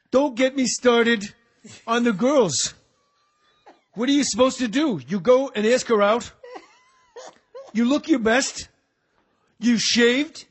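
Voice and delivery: a Keitel impression as a washed-up eighties comedian; Harvey Keitel doing stand-up. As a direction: ham up a gruff actor doing club stand-up. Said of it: "Harvey Keitel doing bad eighties stand-up".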